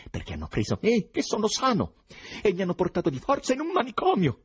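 high, loud, indignant protest; angry patient protesting, building to a high pitch; full of rage. A narrator's reading. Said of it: "pieno di ira". (pieno d'ira, a voce alta)

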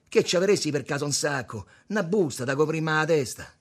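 high, loud request; calling out a request. A narrator's reading. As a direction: ask across the bar. Richiesta al barista attraverso il bar. (richiesta ad alta voce)

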